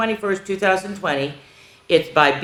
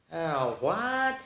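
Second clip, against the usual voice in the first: hushed, drawn-out disbelief; mock dismay; 10 dB quieter than usual. Reader appalled after singing, quiet comic dismay. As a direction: let it out small and quiet.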